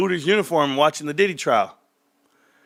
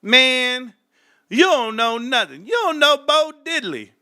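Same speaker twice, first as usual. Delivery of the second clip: raised pitch, scoffing; mock old-timer scolding; 8 semitones higher than usual. Imitating old folks scolding a youngster, scoffing. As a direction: scold like an old-timer who can't believe you. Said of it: "they always say".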